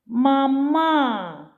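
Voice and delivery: "Мама" said as ma-MAA, second syllable long and strong; stress on second syllable. Shown as a demonstration. Teacher testing a shifted stress, "Мама" stressed on the second syllable. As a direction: wrongly stress the end of "Мама", stretching the last vowel. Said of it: "щоб другий склад виявився з наголосом".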